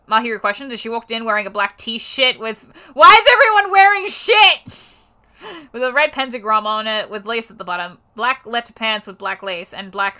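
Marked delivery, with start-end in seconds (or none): 2.9-4.6 loud high disbelieving yell; exasperated outburst. Real, not acted. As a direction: blurt it out in disbelief. Reader's outburst at fic.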